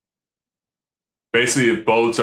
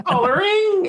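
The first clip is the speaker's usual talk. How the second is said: mimicked weird high-pitched sound; strange noises. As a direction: imitate an odd high noise on the word. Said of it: "making strange noises".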